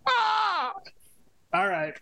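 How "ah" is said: high, mechanical robot squawk; a sharp glitchy robot cry. Acted. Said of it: "Dan doing a robot voice".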